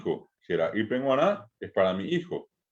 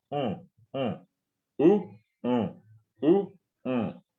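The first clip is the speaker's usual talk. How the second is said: u sounds with the nose pinched closed; nose held shut; 3 semitones higher, much slower than usual. Shown as a demonstration. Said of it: "se tapan la nariz y dicen".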